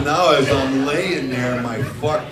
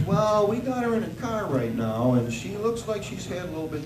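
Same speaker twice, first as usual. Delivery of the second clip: lightly voiced cop, higher; police officer; 6 semitones higher, 4 dB quieter than usual. A policeman phoning the father at four in the morning, lightly voiced. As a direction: put on a cop's voice, lightly. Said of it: "He says".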